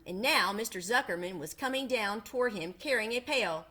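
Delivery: usual speaking voice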